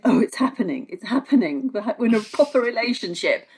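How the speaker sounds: giddy and hopeful, voice lifting; excited. Retold or imitated. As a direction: gush like you're falling in love. Imagined lover's excited thought.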